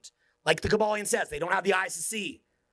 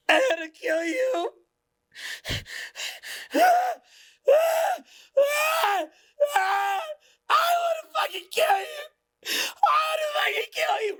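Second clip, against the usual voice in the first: high, crying shriek of pure hate; possessed screaming hatred; 5 dB louder, slower than usual. Retold or imitated. Programmed person turning on you, shrieking and crying. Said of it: "Like Matrix Smith".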